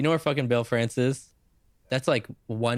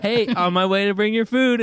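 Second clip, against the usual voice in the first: higher and louder, overly bright; fake-cheerful courier message; cheery courier after crashing, sing it out; chirpy fake cheer; 9 semitones higher, 6 dB louder than usual. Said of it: "you'd be like".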